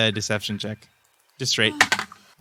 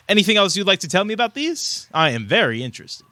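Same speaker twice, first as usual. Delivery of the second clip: high-pitched, eager elvish scholar; inquisitive elvish tinkerer; 8 semitones higher than usual. Acted. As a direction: probe with a scholar's keen interest.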